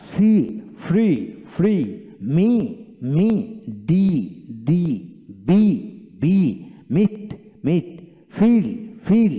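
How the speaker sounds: "সি", "ফ্রি", "মি" loud, with stretched ee; lengthened ee. Shown as a demonstration. Teacher drills "ফ্রি", "মি", "ফিল" with long ee. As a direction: hold the ee long in each of "ফ্রি", "মিট", "ফিল". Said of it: "কিছুটা দীর্ঘিত উচ্চারিত হবে".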